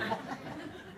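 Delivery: breathy giggle. Real, not acted. Laugh right after name.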